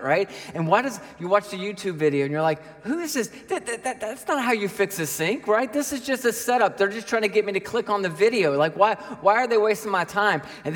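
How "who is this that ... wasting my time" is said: high-pitched, sputtering mock indignation; hammed-up exasperated griping; indignant disbelief. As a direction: complain as if you've been tricked. Acts out irritation at YouTube video.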